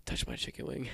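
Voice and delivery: hushed, voiceless mock-creepy invitation; mock whisper. Friend mimicking how he made everybody touch it, mock whisper. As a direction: whisper it as a teasing imitation. Retold quote of odd friend.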